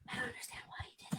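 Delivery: fast, tearful whisper; a real choked whisper. Real, not acted. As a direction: whisper it holding back tears. Near tears, whispering.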